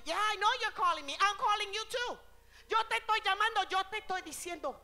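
loud, high preaching in Spanish and English; full-force preaching. Acted. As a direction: preach it out to the whole church. Preacher addressing congregation.

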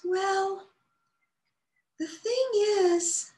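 high, slow, small hesitant squeak; teeny tiny voice. A narrator's reading. A shy bud starting to explain, tiny voice. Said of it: "in a teeny tiny voice".

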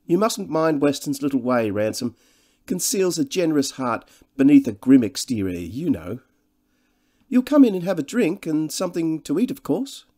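high, loud, gushing society man; jovial host. Acted. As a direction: introduce your old friend with great fuss. Devine's lines in the novel.

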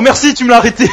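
high, loud burst of relief; excited shout. Real, not acted. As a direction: yell thanks in sheer relief. Relieved cry after a save.

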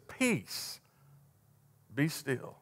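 very soft, hushed command; calm command. Acted. Jesus to the raging storm, soft and calm. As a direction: still the storm with a quiet word.

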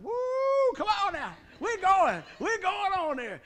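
excited whoop. Retold or imitated. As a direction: burst out like a dog ready to run. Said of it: "I'm like".

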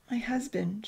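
soft, tentative opening; hushed and unsure; hesitant. Acted. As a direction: begin hesitantly, almost under your breath. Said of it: "she began hesitantly".

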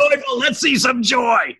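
rally them with a high shout; a preacher's high cheer for joy; rousing shout. Real, not acted. Urging listeners to be cheerful.

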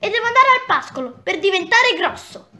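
high, thin little goat voice; tiny thin voice. Acted. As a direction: squeak it like a tiny kid. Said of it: "con una vocina sottile sottile".

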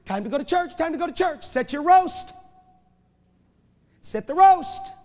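high, loud, hurried mock devil hustling people; hammed-up devil rushing churchgoers out the door; devil's wake-up call. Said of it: "He wakes up people every Sunday morning and says".

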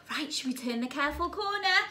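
plain reading voice

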